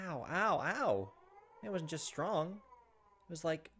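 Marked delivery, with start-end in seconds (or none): 0.1-1.1 repeated higher-pitched ow; mock pained ows. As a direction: act out little yelps of pain. Voicing the boy's pain.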